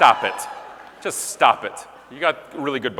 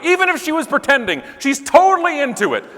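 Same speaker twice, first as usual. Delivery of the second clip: ordinary talk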